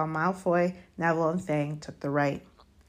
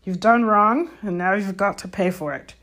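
stern, louder opening, easing off; fierce; 4 semitones higher, 7 dB louder than usual. A narrator's reading. Hagrid scolding Malfoy for his refusal, fiercely. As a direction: lay down the law. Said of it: "said Hagrid fiercely".

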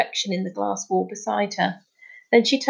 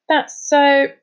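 indignant outburst, louder and higher; cross, raised storybook voice; growled; 6 semitones higher, 5 dB louder, slower than usual. A narrator's reading. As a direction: snap it out in exasperation. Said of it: "growled Charlie".

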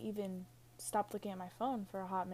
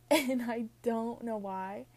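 put-on slow, drawn-out, louder Valley girl accent; 5 dB louder, slower than usual. Shown as a demonstration. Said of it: "I kind of sound like a Valley girl".

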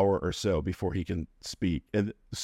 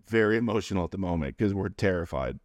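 ordinary talk, nothing marked

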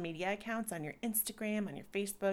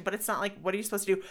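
ordinary delivery

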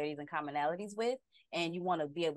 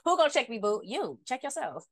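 sassy comeback; 6 semitones higher than usual. Pretend. Playful comeback.

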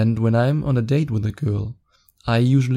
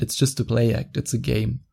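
usual speaking voice

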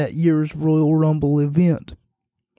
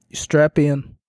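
ordinary delivery

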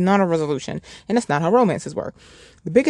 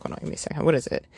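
whispered aside; 7 dB quieter, much faster than usual. Real, not acted. Off-mic aside to someone nearby.

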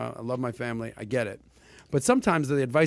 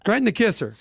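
mock angry threat; 7 semitones higher, 5 dB louder than usual. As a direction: scold grandpa like a cranky old man.

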